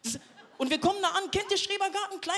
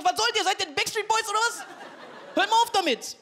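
high-pitched exasperated telling-off; exasperated retort; 7 semitones higher than usual. Retold or imitated. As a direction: scold them, voice jumping up. Telling off wobbling grandparents. (genervt, hoch, Standpauke)

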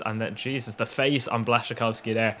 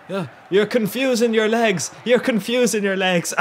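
dazed half-asleep babbling; 9 semitones higher than usual. Retold or imitated. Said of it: "And I said".

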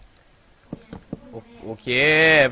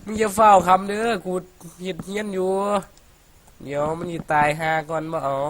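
joking Isan dialect, higher pitched; mock Isan accent; 5 semitones higher than usual. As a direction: tease in a playful Isan accent. (สำเนียงอีสานแบบล้อเล่น)

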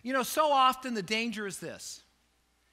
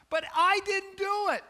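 put-on high, louder childish whine; whiny protest; 13 semitones higher, 5 dB louder than usual. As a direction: whine like a kid caught out. Retold whining protest to doctor.

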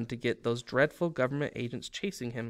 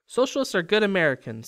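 higher, heated retort; angry; 7 semitones higher, 4 dB louder than usual. A narrator's reading. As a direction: snap back in her defence. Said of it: "said the woman in purple angrily".

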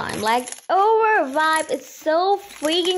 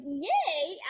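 high-pitched little cheer; mock cheering; 4 semitones higher, 9 dB quieter than usual. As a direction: cheer as the relieved friend, pitched up. Acting out reaction to friend arriving.

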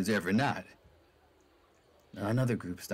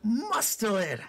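squeaky exclamation; 13 semitones higher, much slower than usual. Acted. High comic exclamation.

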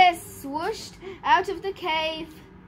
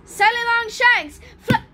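loud, raised storybook incantation; spell casting; 5 semitones higher, 7 dB louder than usual. A narrator's reading. Cosmo waves his hand at the monster, loud spell casting. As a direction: call out the magic words with force. Wizard casts a magic spell.

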